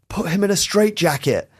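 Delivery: high-pitched mock heckle from a crowd; imitated yell. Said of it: "someone in the crowd yells".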